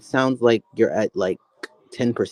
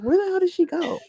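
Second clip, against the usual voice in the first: squeaky high bewildered voice; confused high quote. Retold or imitated. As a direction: pitch way up, baffled. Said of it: "I'm like".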